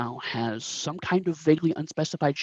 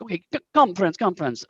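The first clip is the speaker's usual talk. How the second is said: high, loud fake Korat accent; beckoning, hammy Korat accent; Mister Meow; 6 semitones higher, 5 dB louder than usual. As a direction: usher guests along in a bad Korat accent. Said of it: "very thick, um, Korat accent".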